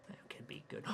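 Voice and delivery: hushed whisper to himself. Acted. Hayden whispers his dark idea. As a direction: breathe it out quietly.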